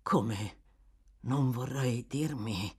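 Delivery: low, slow murmur of dawning dread; dismayed murmur. Acted. Toby realises Bill is missing, dismayed murmur. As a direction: murmur it low, as if turning pale. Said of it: "mormorò Toby impallidendo".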